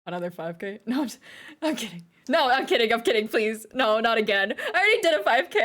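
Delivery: ordinary talk, nothing marked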